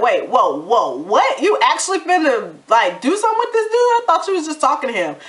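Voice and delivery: hammed-up astonished daddy voice; mock shocked dad. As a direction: play the startled father who just caught on. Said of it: "He's like".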